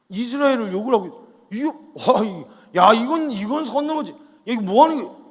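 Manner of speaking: outraged disbelief. Acted. David hearing God insulted, growing furious shouts. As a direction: let the outrage build to a loud boil.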